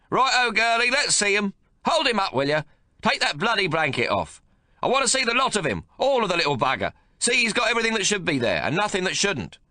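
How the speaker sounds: put-on high, loud, bossy old grandfather; gruff domineering old man. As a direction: bark orders at the new mother. Old man voiced in story.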